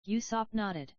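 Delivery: ordinary delivery, nothing marked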